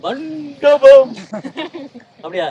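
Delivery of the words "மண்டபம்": மண்டபம் shouted high and drawn out; emphatic stress. Shown as a demonstration. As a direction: punch out மண்டபம் very loud, stretching it long. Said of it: "அழுத்தி சொல்ல". (மண்டபம் என்று உரக்க, நீட்டி அழுத்திச் சொல்)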